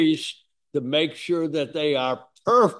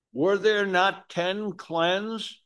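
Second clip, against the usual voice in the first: slow, higher, solemn question; Jesus asking; 6 semitones higher, slower than usual. Acted. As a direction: voice Jesus gently probing, slowly.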